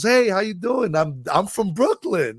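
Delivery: lightly voiced upbeat seatmate; Brooklyn lady's greeting. Brooklyn lady greeting her new seatmate, lightly voiced.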